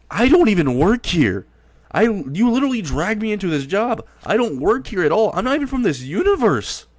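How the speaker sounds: high-pitched, exasperated protest; rising panic, he never signed up; high indignant protest. Acted. Izuku protests he doesn't work here.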